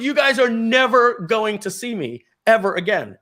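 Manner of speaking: loud, high defiant shout; shouting farewell. Retold or imitated. Quitting salesman shouts goodbye at coworkers, loud and high. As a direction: bellow it as you walk out.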